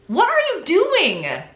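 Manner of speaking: high, drawn-out incredulous cry; exasperated disbelief. Real, not acted. Host reacting to the absurd lies, exasperated.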